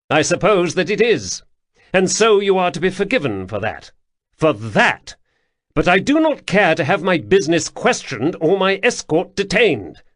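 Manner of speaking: commanding high voice, clipped authority; a young prince pulling rank on a guard captain; imperious prince. Acted. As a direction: loom over him as the prince.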